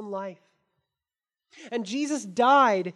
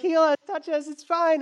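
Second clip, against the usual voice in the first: higher, louder begging voice; pleading crowd; 4 semitones higher, 5 dB louder than usual. Retold or imitated. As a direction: beg to be healed. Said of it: "they're like".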